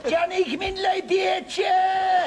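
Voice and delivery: high, loud Cork street shout; Cork coal quay accent. Retold or imitated. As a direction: bawl it like a Cork market trader. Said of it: "The bit you get down the coal quay".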